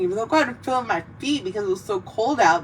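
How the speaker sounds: ordinary talk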